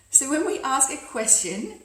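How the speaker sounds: lifted tone, "question" swung upward at the end; rising inflection. Shown as a demonstration. Coach showing how asking sounds, rising inflection on "question". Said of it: "our voice and inflection typically rise".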